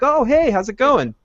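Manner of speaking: high, lilting imagined hello; happy greeting. Retold or imitated. Glad to greet a friendly acquaintance, happy greeting. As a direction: act out a glad, casual welcome. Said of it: "really actually happy to greet her".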